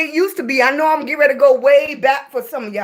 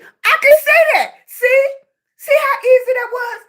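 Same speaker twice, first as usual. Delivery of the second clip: excited; 12 semitones higher, 3 dB louder than usual. Real, not acted. Proudly nailing the name Desiree.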